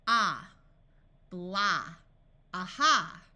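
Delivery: "Ah", "blah", "aha" with a long open ah, said loud; broad A sound. Shown as a demonstration. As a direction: open the mouth wide on every "ah". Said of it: "The broad A sound of ah".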